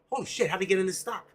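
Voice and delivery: startled exclamation. Retold or imitated. Trader finding an accidental stock buy, startled blurt. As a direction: blurt it out in quick disbelief. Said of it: "and going".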